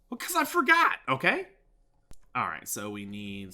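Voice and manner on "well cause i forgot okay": high, louder exasperated retort; mock defensive. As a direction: protest it in a pitched-up voice. Mock retort to imagined viewer's objection.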